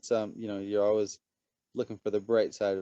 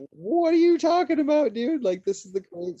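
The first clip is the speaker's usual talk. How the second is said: very high, loud squeal of delight; ecstatic exclamation; 9 dB louder than usual. Retold or imitated. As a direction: gush at him like a fan. Retold exclaiming to new friend.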